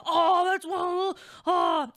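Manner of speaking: loud, high mock gasp; imitating dad deflating; dad hears it's a boy; dad's startled shout.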